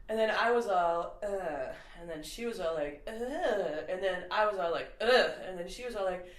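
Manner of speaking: lightly imitated back-and-forth of wordless grunts; Adam Sandler bit. Retelling Sandler's SNL sketch to a friend, imitated grunting dialogue. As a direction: retell a quarrel in grunts and whines, swelling. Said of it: "something like this".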